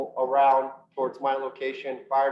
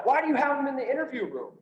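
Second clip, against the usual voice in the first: high, pitched-up mock yell of a boss; yelling at the guy, much higher than his own voice; retold yell; 9 semitones higher than usual. Boss demanding why the officer sits in the interview room, high retold yell. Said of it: "I could hear him yell at the guy".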